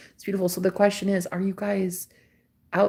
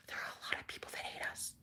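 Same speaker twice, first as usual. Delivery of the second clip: very soft whisper; whispered aside; 24 dB quieter, faster than usual. Real, not acted. Admitting to viewers that many hate us, whispered. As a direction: whisper it fast, as a confidential aside. Hushed aside about being hated.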